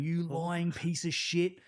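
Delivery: high, drawn-out angry insult; retold rage. Soldier laying into a lying mate, retold anger. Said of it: "I just blacked out anger".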